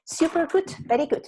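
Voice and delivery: high, upbeat praise; teacher praising a student's answer, excited; bright praise. Real, not acted. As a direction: cheer the student on. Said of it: "praising student".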